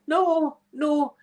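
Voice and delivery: high, drawn-out mock-desperate no; hammed-up high plea; dramatic cry of no. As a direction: call out as the lover running back. Lover running back, crying out.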